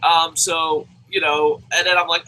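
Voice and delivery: usual speaking voice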